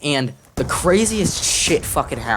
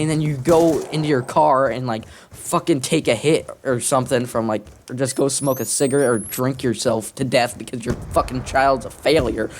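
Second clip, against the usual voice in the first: normal talking voice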